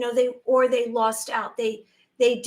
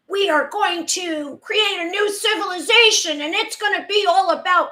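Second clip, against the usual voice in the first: high, loud declamatory announcement; grand mock speech, higher and louder; mock world-order proclamation; 4 semitones higher, 4 dB louder than usual. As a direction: proclaim it like a pompous leader, pitched up. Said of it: "I'm not doing the German voice".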